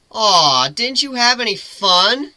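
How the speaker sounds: high, loud, playful griffin voice; griffin teasing. Acted. Griffin teasing Lyra mid-fall, high playful voice. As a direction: tease her lightly as you both fall.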